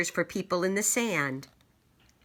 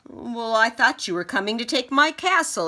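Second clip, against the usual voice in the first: king's excuse; 6 semitones higher, 5 dB louder than usual. Acted. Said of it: "he said".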